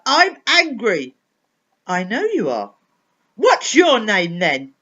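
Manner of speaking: slow, loud, sullen shouting; loud angry boy. Acted. Angry boy snapping at the new superpower. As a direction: drag it out like a furious child.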